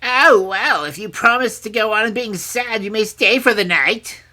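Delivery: high, loud, mocking crone; mocking old woman. A narrator's reading. Old woman letting the princess stay, mockingly. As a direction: jeer at her in an old crone's voice. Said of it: "said the old woman mockingly".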